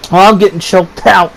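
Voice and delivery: normal talking voice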